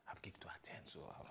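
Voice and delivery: hushed muttered excuse; whispering. Acted. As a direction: slip the excuse out quietly.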